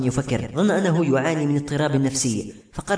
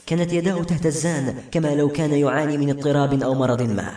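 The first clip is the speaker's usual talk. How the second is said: plain reading voice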